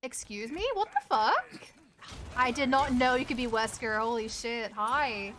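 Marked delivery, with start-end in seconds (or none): none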